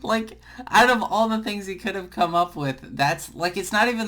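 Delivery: breaking into real laughter mid-complaint; laughing. Real, not acted. Laughs at co-host's joke.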